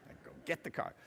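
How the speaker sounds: breathy whispered aside, barely voiced; unvoiced hushed murmur of what she hoped he'd say. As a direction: drop to a whisper as if letting them in on a secret. Imagined gallant reply, murmured aside.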